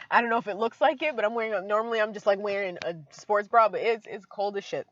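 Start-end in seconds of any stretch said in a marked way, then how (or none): none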